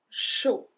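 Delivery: "Chaud" ending on o, like "sho"; silent final D. Shown as a demonstration. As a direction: drop the d at the end of "Chaud". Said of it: "we don't pronounce the D at the end".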